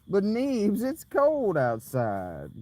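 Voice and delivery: slow, mock-whiny plea; lightly voiced whiny friend; imitating Shaggy. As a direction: drawl it like a pleading friend. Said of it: "Shaggy said".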